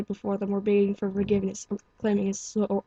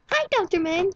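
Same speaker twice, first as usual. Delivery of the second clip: much higher, bright cheery hello; bubbly pink pony; 11 semitones higher, 4 dB louder than usual. Acted. Pink pony greets the doctor with a high chirpy hello.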